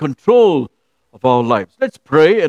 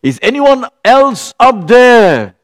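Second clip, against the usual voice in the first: higher, louder, slow desperate call; man on the cliff calls past God, louder and slower; calling up again; 8 semitones higher, 6 dB louder, slower than usual. Retold or imitated. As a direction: drag it out, hoping someone else answers. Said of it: "he looked up again and he said".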